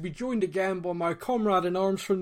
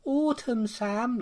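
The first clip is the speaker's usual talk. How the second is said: high, falling "Autumn, Sam"; imitated guest's "autumn"; 6 semitones higher, much slower than usual. Host mimicking how last week's guest said autumn, high-pitched imitation. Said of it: "like that, which made me laugh".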